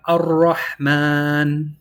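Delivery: Ar-Rahman slow with a rolled R. Shown as a demonstration. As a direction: roll the R in Ar-Rahman, drawn out. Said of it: "We have".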